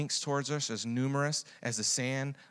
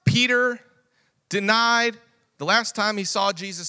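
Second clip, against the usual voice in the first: preaching at full force; 11 semitones higher, 11 dB louder than usual. Acted. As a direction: preach it out to the whole church.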